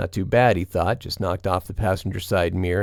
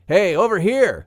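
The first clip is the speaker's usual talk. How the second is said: much higher, louder shout; yelling; 11 semitones higher, 4 dB louder than usual. A narrator's reading. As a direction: yell to the car while running. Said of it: "Duke yelled".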